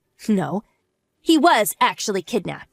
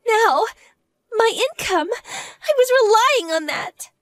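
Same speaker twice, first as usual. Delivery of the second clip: tearful whine; 10 semitones higher, slower than usual. Acted. Losing husband's salary.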